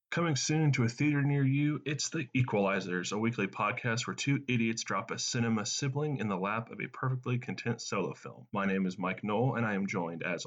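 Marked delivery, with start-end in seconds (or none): 0.1-8.5 hammed-up trailer announcer opening; movie trailer voice. Host opening the show as a mock trailer. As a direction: sell the podcast like a coming attraction.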